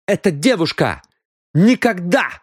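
high, loud, NEVER hit; furious exclamation. A narrator's reading. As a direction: explode at the idea. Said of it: "яростно воскликнула Мот". (Мот яростно восклицает)

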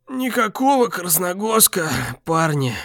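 wheezed out, high and quiet; wheezing. Acted. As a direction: rasp it out wounded. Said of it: "просипел Алекс".

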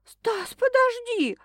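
high, slow pleading female voice; whimpering woman. A narrator's reading. Frightened girl begging Stas to wait, high and slow. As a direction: whine his name, drawn out and high. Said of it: "заскулила она".

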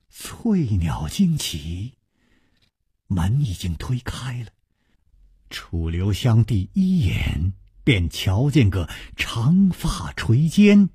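ordinary delivery

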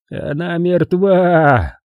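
high, drawn-out, hopeless statement; resigned certainty, stretched out long. A narrator's reading. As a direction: say it very slowly, certain and without hope. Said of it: "с безнадёжной уверенностью в голосе".

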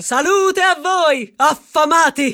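slow, booming hail to the crowd; slow proud call to the hungry; loud greeting. Acted. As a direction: hail them from the podium. Addressing the hungry crowd loudly.

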